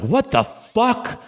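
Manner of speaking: high, drawn-out stunned reaction; slow, high disbelief; shocked. Retold or imitated. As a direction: imitate the friend's shock, slowly. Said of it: "and you're like".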